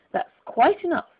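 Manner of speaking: high, startled exclamation; alarmed. A narrator's reading. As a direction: stop it in a fluster. Alice alarmed at growing.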